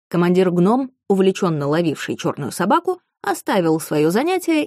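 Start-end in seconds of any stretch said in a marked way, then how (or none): none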